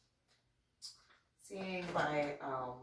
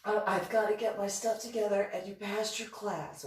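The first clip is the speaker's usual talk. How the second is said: flustered insistence; 4 semitones lower, 8 dB louder than usual. Retold or imitated. Retold teacher fending off student.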